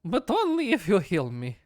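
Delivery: slow, proud creature; haughty creature voice. Acted. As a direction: talk down to lesser creatures.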